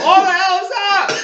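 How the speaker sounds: loud high-pitched retold shout; mock parental yell. Mom approaching the wrecked room, mock parental yell. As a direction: holler it high, imitating Mom. Mom heard coming, reenacted.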